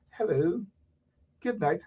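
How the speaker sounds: soft, meek little greeting; mock a prim guest quietly; timid proper speaker. Mocking those sitting with folded legs, meek. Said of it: "sitting there and folding your legs".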